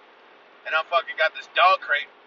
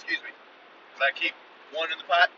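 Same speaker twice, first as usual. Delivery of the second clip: ordinary talk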